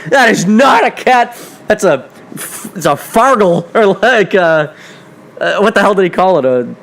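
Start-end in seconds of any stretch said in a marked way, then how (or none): 0.1-3.7 insistent. Retold or imitated. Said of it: "He goes".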